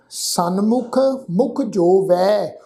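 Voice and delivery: ਜੋ ਵੈ spoken slowly with a clear ਐ on ਵੈ; slow careful pronunciation. Shown as a demonstration. Teacher models ਜੋ ਵੈ for learners, slow and careful. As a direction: draw out ਜੋ ਵੈ deliberately. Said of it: "ਜੋ ਵੈ ਵਾਵੇ ਅੱਖਰ ਤੇ ਦੁਲਾਵਾਂ ਦਾ ਪਾਠ ਹੈ".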